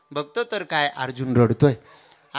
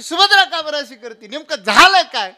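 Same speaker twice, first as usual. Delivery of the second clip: high, loud baffled question; bewildered shout; 8 semitones higher, 16 dB louder than usual. Acted. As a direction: cry out in confusion. Balram bewildered by Subhadra. (सुभद्रेच्या वागण्याने गोंधळलेला दादा, मोठ्याने प्रश्न)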